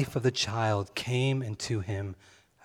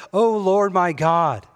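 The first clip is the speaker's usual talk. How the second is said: slow, loud, higher cry of O Lord my God; raised-voice cry; 8 semitones higher, 9 dB louder, slower than usual. A narrator's reading. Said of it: "He raises up his voice and he cries".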